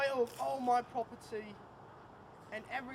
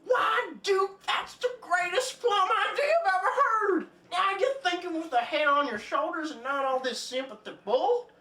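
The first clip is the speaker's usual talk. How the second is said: blustering King; 9 dB louder than usual. Acted. King cheering the Duke's plan, loud and hammy. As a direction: boom it like a greedy old king.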